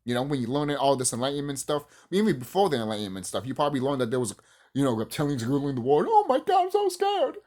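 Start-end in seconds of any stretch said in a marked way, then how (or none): 6.1-7.4 high, breathy mock fright, very high; mocking the scared believers in a squeaky voice; mock scared squeal. Vlogger mocks people scared of reptilians, high mock squeal.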